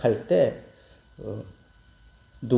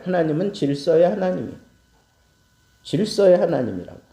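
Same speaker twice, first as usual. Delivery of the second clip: normal talking voice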